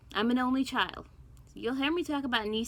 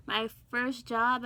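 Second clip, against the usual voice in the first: usual speaking voice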